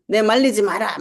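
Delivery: hammed-up "don't stop me" protest; loud mock defiance; mock angry outburst. Acted refusal to be restrained.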